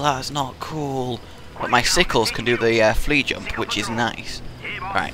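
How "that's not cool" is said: dragged out, quiet and slow; slow disappointed repeat. Shown as a demonstration. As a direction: let it sink in slowly.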